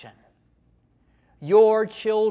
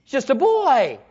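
Goliath; 5 semitones higher, 5 dB louder than usual. Acted. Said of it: "Goliath says".

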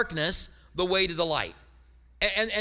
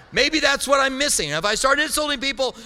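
high, loud eureka moment; mock revelation; 7 semitones higher, 5 dB louder than usual. Pastor joking about insulting his congregation, excited mock revelation. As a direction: exclaim it as if struck by a silly idea.

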